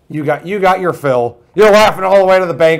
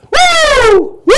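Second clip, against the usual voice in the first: high, loud wrestler's whoop; hammed-up wrestling-star holler; Ric Flair; 7 dB louder than usual. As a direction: let out a showboating woo. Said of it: "Ric Flair will".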